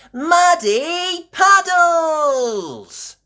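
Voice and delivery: high, loud drawn-out cheer. A narrator's reading. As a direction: cheer it out in high-pitched glee. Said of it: "cheered Peppa".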